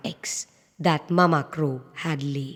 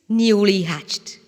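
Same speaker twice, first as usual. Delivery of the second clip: ordinary delivery, nothing marked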